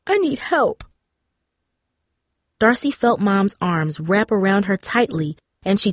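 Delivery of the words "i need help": high, small, crying voice; tearful plea. A narrator's reading. Darcy asking her family for help, tearful and high. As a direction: plead helplessly through tears. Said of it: "tears flowing freely from her eyes".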